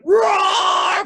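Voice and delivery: high, loud, yowling roar; lion's scared roar. A narrator's reading. Lions scared off by elephants, high loud roar. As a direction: roar high like a lion bolting. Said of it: "And lions went".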